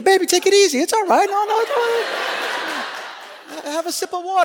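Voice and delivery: high, laughing mock-soothing voice; wife calming him. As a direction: play the amused wife settling him down. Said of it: "And Rose go".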